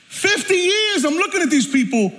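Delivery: high, driving preacher's cry; impassioned preaching. Real, not acted. Preacher confessing years of judging his family, high impassioned preaching. Repeated at full preaching force.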